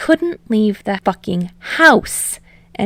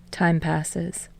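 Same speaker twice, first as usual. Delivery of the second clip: plain reading voice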